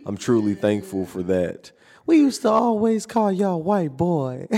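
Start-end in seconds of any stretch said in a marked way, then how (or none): 2.1-4.5 much higher put-on auntie voice; high teasing auntie, lightly mocking. Nephew mimics aunt teasing him at Thanksgiving, high mock voice.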